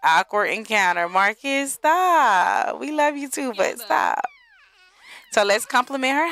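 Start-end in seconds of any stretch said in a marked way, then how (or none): 1.1-4.2 playful scolding. Real, not acted. Telling a Sim to stop flirting.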